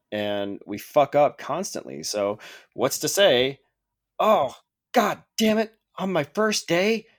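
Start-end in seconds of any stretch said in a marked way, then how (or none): 4.2-7.1 high-pitched mock dismay; acted, higher-pitched exasperation; exasperated dismay. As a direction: curse your own blunder as a hapless new hire. Voices a researcher who messed up.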